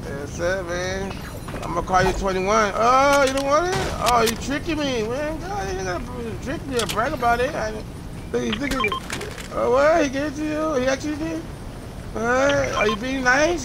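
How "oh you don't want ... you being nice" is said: pitched high, amazed and delighted; can't believe the kindness, voice up high; surprised high disbelief. Real, not acted. Streamer amazed as a stranger teammate gives him loot, high surprised voice.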